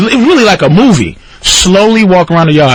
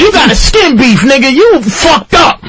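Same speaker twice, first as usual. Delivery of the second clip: high, emphatic gangster warning; imitated high-pitched exclaiming; 9 semitones higher than usual. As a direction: act the alarmed gangster, dragging out the last words. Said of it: "This is gangsta outside".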